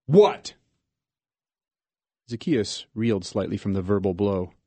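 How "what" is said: much higher and louder angry bark; furious outburst; explosive shout. Acted. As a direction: explode at the visitor. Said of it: "he exploded".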